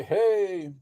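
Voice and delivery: ordinary talk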